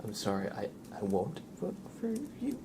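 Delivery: ordinary delivery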